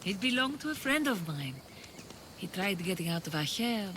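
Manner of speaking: ordinary talk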